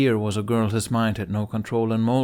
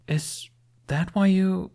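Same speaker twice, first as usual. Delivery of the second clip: higher voiced Viking girl, earnest; young woman voice; 10 semitones higher, 3 dB louder, slower than usual. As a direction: lift the pitch for a proud young warrior. Astrid voiced by narrator.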